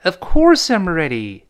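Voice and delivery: slow model "Of course I'm ready", "ready" with a curled-tongue R; stressed "course", then "ready" ending on ee. Shown as a demonstration. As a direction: curl the R in "ready", close on a clear ee. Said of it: "ready还是勾舌头那个R".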